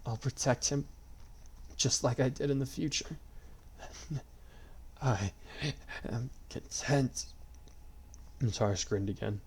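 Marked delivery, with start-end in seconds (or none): 0.0-7.3 faint whisper. A narrator's reading. Dying man at peace.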